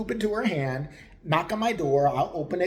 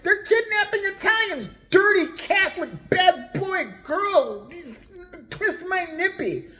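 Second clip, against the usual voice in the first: much higher and louder, slow preachy wail; crazed sign lady; 13 semitones higher, 4 dB louder than usual. Acted. Narrator voicing the neighbor's crazy signs, high shrieking prophet voice. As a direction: proclaim like a doomsday prophet.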